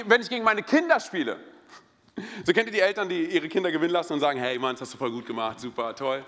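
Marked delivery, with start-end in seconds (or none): 4.4-6.2 low, soft mock praise of a child; lower, understated pat-on-the-back voice; indulgent parent praise. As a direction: imitate parents going easy on the kids.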